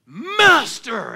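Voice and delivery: loud, high call of "Master!"; desperate cry. Retold or imitated. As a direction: shout "Master!" as if Jesus is far away. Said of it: "But they cried out".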